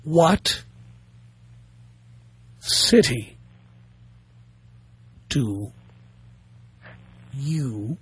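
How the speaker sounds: slow and deep, word by word. Shown as a demonstration. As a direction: spell it out one word at a time. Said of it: "I'm gonna say it slowly".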